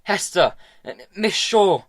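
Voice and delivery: high loud call across the mud. A narrator's reading. Tom calling out to Hester as she stomps away. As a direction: shout her name after her.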